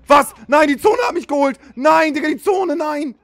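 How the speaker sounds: high, loud despairing yell; frustrated shout. Real, not acted. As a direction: yell it in disbelief. Killed by the zone.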